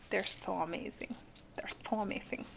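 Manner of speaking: soft breathy whisper to the chat; hushed, awed whisper about her stones. Real, not acted. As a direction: whisper it like a secret. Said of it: "I don't know why I'm talking like that".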